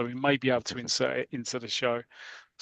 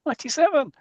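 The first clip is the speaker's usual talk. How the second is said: high loud sung-out number; excited shout; 13 semitones higher, 3 dB louder, faster than usual. Pretend. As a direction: belt the number like a jingle. Repeats number as a jingle.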